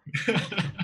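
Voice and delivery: a quick, soft, high giggle; soft laugh. Real, not acted. Host giggling at his own puppy-size joke, soft laugh. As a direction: let a little laugh slip out.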